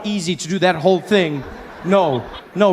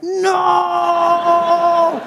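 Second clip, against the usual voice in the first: much higher, loud yell of alarm; panicked shout; 12 semitones higher, 3 dB louder than usual. Retold or imitated. Car coming towards him.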